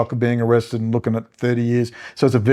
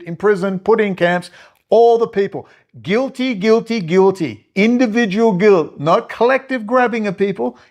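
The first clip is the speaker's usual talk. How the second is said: loud sharp voice; 7 semitones higher than usual. Real, not acted. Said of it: "sorry for yelling so loud".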